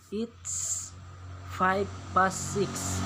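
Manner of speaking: slow, clear "five past six"; slow teacher reading. Shown as a demonstration. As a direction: read it out slowly as a model. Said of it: "Ustadz bacakan perbedaan". (guru membaca pelan dan jelas)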